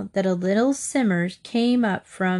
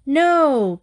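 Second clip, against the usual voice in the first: higher, louder stubborn refusal; 6 semitones higher, 5 dB louder than usual. A narrator's reading. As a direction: dig your heels in. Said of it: "Katy said stubbornly".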